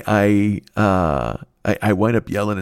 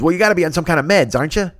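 neighbor Lou; 7 semitones higher, faster than usual. Retold or imitated. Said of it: "He goes".

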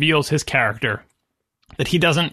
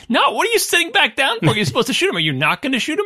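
exasperated viewer; 8 semitones higher than usual. Retold or imitated. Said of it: "and we're like".

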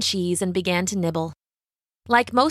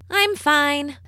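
put-on higher-pitched girl's voice; captive girl; 7 semitones higher than usual. A narrator's reading. Captive answers the witch, in a higher girl's voice. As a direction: lift the voice into a young captive's short reply. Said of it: "said Rapunzel".

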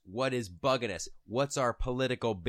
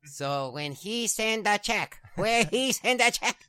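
high, slow put-on voice getting louder; Japanese bank teller; 10 semitones higher, 5 dB louder, slower than usual. Baffled teller asking dad about the check, exasperated. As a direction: push the repeated question higher and louder. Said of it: "says the lady".

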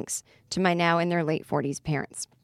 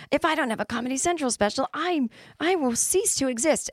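1 dramatic despair; 8 semitones higher than usual. Retold or imitated. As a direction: ham up the end of the world. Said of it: "I was like".

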